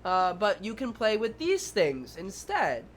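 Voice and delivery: put-on calm a small child down, offering another choice; reassuring teacher. Teacher to a child throwing a tantrum, high and reassuring. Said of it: "you're like".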